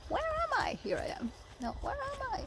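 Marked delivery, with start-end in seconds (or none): 0.1-0.8 squeaky puzzled. Real, not acted. Lost in camera frame. 1.6-2.4 squeaky puzzled. Real, not acted. Lost in camera frame.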